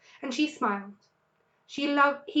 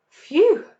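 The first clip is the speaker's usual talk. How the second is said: relieved phew; 8 semitones higher, 12 dB louder than usual. A narrator's reading. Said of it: "said Mister Fox".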